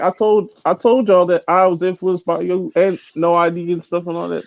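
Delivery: high-pitched, defensive quote; retold protest. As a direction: imitate someone pleading their case, voice up high.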